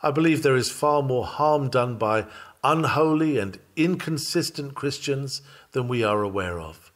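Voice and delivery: ordinary talk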